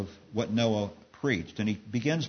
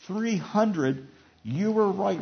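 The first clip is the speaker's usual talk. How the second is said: higher-pitched disbelief; incredulous; 6 semitones higher than usual. A narrator's reading. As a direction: marvel in disbelief, without laughing. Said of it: "laughed incredulously".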